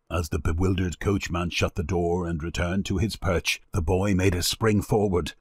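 usual speaking voice

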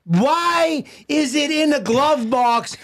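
high, drawn-out indignant question; exasperated rant. Acted. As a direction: stretch it out in comic outrage.

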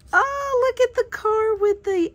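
sudden gasp then high oh; delighted gasp. Real, not acted. Spotting the little car sticker: gasp and high surprised oh. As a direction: catch your breath in surprise.

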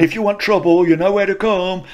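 high-pitched mock threat from departing lads; mimicked taunting kids. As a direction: imitate boys calling out a parting threat. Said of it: "like that".

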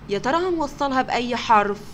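normal talking voice

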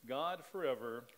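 ordinary talk

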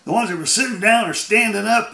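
usual speaking voice

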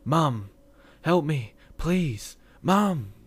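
high, loud screams for help; panicked shrieks of a terrified child; desperate screaming. Acted. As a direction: scream for mum as if grabbed from behind. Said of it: "I screamed as loud as I could".

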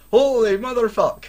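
startled scream of shock; shocked yell. Real, not acted. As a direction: blurt it out in disbelief. Shocked reaction to [name].

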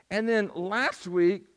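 a little squeak slipping into the voice; squeaky voice. Real, not acted. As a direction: voice squeaking up on the words. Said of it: "see my squeaky voice?".

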